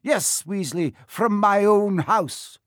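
high, indignant teacher's scolding; outraged telling-off, key words drawn out; losing her temper. A narrator's reading. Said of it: "she lost her temper".

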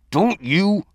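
sharp angry bark; furious yell. Acted. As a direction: shout him down. Chief yelling in the fight.

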